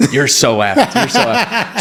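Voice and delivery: high laughing you're so effed; laughing mock warning. Dad teasing son about the fight, laughing. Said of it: "He's like".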